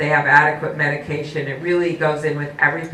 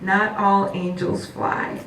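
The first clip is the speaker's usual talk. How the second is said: tender reassurance; 5 semitones higher than usual. Retold or imitated. Mother comforts daughter.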